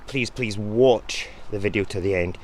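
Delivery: ordinary talk, nothing marked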